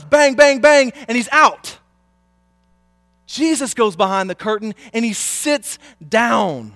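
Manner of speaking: high, loud, impassioned exhortation; full-force preaching. Real, not acted.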